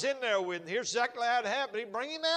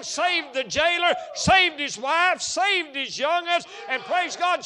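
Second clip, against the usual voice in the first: loud, higher, impassioned sermon voice; full-force preaching; 5 semitones higher, 7 dB louder than usual. Acted. Preacher exhorting congregation about Joseph, loud impassioned preaching. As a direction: preach it hard to the back pew.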